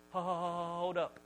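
drawn-out stuck first sound; mock stammer, stretching the opening syllable; stutter. Moses balking at God's order, stuttering. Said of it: "Moses had a stutter".

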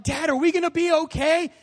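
high, pleading question; a frightened boy asking dad; small scared voice looking up at father; scared child. Retold or imitated.

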